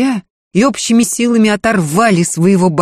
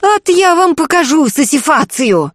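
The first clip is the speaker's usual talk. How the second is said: high angry bark; barking housekeeper; 10 semitones higher than usual. A narrator's reading. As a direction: bark it at him. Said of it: "рявкнула Дунька". (рявкнув)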